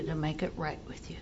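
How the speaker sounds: hushed, humble whispered question. Retold or imitated. Sponsee humbly asking her ex-husband how to make amends, whispered. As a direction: breathe it out as a humble plea.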